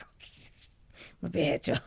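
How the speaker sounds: sheepish laugh. Retold or imitated. Backing down sheepishly.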